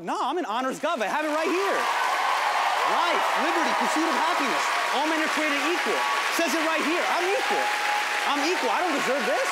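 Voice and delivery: loud, high outraged protest; indignant shouting. Retold or imitated. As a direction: shout the Constitution back at him. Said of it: "I'm like".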